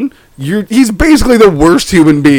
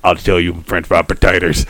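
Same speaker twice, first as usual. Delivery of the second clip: very low, softer Sling Blade voice; 14 semitones lower, 6 dB quieter than usual. Acted. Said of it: "redneck Aramaic".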